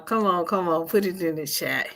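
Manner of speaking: ordinary talk, nothing marked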